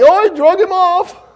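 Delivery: high, loud, hammed-up brother's excuse; high mock reply. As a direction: toss off the excuse in a high whine. Brother answers in put-on voice.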